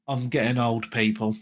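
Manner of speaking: normal talking voice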